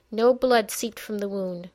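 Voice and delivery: plain reading voice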